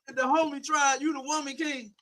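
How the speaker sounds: ordinary talk, nothing marked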